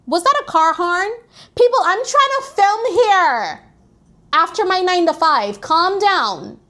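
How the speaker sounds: annoyed call to the street. Real, not acted. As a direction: shout over the car horn. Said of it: "Thank you".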